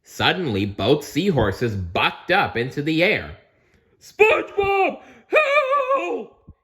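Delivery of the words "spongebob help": high, loud panicked cry; yelling for help. Acted. Patrick thrown by his seahorse calling SpongeBob, panicked yell. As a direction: scream for your friend mid-buck. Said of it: "yelled Patrick".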